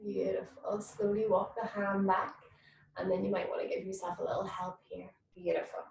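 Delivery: whispered. Real, not acted. Soothing stretch cue.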